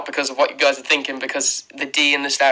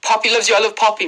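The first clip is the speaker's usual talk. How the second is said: ordinary talk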